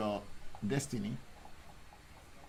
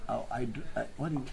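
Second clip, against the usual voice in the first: normal talking voice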